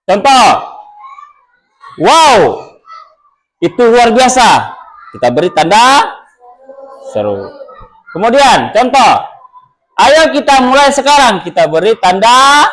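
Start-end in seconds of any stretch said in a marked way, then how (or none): 2.0-4.8 teacher acting out a delighted exclamation; exclaiming demo. Shown as a demonstration. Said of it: "kalimat perintah atau seruan". 10.0-11.4 higher-pitched rousing "Ayo kita mulai sekarang"; mild mock rallying command; eager command demo. Shown as a demonstration. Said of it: "kalimat perintah".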